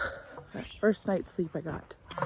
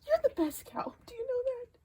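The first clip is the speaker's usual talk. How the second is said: higher, teary praise to the cow; tender praise; 5 dB quieter than usual. Real, not acted. To her cow, high tender praise. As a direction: tell her sweetly she's the best.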